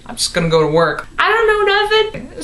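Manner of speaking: fast, squeaking up high on the end; innocent escape. Retold or imitated. Narrator sneaking past to work, faking innocence, fast and high. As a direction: hurry off playing dumb. Her own nervous escape.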